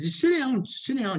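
high-pitched mock order to sit; hammed-up high voice of a bossy woman; imitating a bossy lady. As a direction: squeak it out like a pushy homeowner. Retelling the lady ordering him.

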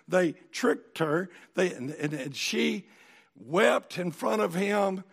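ordinary talk

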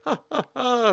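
high-pitched put-on cackle; Helic's laugh. Told it's poisonous, he does his little laugh, imitated. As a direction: imitate a cartoonish staccato laugh. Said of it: "he does his little Helic laugh thing".

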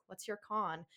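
lightly voiced Belfast accent on "What's your con?"; a hint of broad Belfast in "What's your con?"; mild Belfast accent. Reading slang guide aloud, mild Belfast accent. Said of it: "in a broad Belfast accent".

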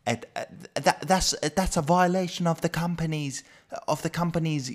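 high, drawn-out posh British voice; shrill posh English. Retold or imitated. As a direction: mimic the posh Englishman citing rules. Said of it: "semmoisella posh e-, English".

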